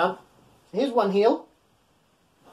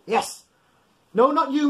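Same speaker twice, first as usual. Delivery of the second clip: ordinary talk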